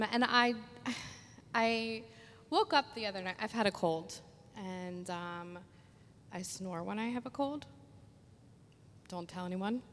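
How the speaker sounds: normal talking voice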